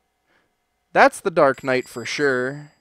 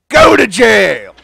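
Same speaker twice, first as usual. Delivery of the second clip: loud, slow decree; slow loud mock verdict; mock sentence; 3 semitones higher, 12 dB louder, slower than usual. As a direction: declare it like a judge. Sending customer to jail.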